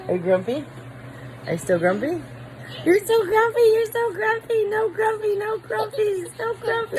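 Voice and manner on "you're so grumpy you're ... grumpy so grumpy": high mock-crying whine. Real, not acted. Mom mimicking her fussy toddler, high mock whine.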